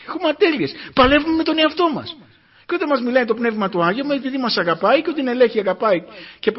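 high, fervent sermon voice; fervent preaching. Acted. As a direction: plead it high and earnest. Preaching, pitch raised high. (ένθερμο κήρυγμα με ψηλή φωνή)

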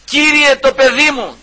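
high, loud desperate plea; crying out. Retold or imitated. Preacher voicing the mother calling after Christ, crying out. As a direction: cry out to the Lord for your child. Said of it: "και φώναζε".

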